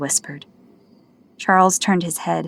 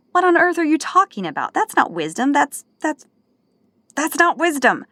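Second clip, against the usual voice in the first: higher, disbelieving outburst; incredulous protest; 10 semitones higher than usual. Acted. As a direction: stammer in outraged disbelief.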